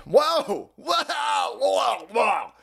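hammed-up wonder; mock amazement. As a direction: gasp like a wowed kid.

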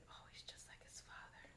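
whispered mom quote; hushed imitation of the mom; quoting the mom. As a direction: breathe it out like a dismissive mother. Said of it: "She said".

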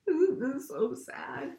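very slow, high, choked-up plea; tearful and slow. Real, not acted. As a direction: drag it out, on the edge of tears. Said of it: "makes me wanna cry".

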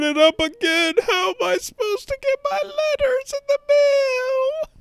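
high, loud, nasal-free whining about snow; whiny complainer voice. Retold or imitated. Comic demo of his complaining voice, high and whining. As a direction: whine like a helpless snow victim. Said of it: "And they whine".

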